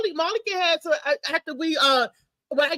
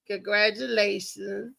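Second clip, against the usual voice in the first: very slow, softer congratulations; drawn-out congrats; 6 semitones lower, 4 dB quieter, slower than usual. Real, not acted. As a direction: stretch it out fondly. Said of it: "toast to Derek".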